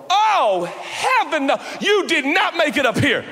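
loud, high, laughing disbelief; amused, outraged surprise; mock shock. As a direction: gasp it out as if you can't believe your eyes. Shocked at who's in heaven.

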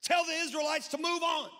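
loud, high divine rebuke; God commanding Moses. Acted. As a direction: thunder it as an exasperated order from above. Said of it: "Then the Lord said to Moses".

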